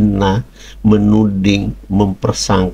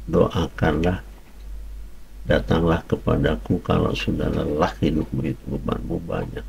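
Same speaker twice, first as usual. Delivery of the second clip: softer, gentle invitation "datanglah kepadaku"; soft quoted promise; 3 semitones lower, 8 dB quieter than usual. Retold or imitated. Preacher quoting Jesus to weary listeners, soft and tender. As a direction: offer rest quietly, like a tender call.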